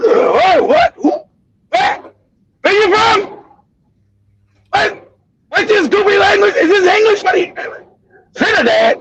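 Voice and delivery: acting out an ignorant, puzzled college kid; ignorant confusion. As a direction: play up clueless disbelief, mock-ignorant. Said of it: "I sound just ignorant".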